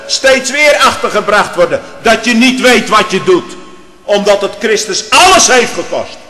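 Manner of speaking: loud, high pulpit voice; fiery preaching. Acted. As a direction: thunder it from the pulpit. Preaching at full force. (vurig preken)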